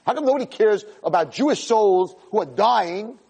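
high, indignant demand; impassioned rebuke. Real, not acted. Rabbi pleading for dying souls, impassioned high indignation.

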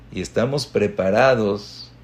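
usual speaking voice

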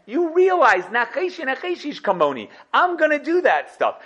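hammed-up proud ruler; Yosef boasting. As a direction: drive home that he will find out. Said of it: "She says".

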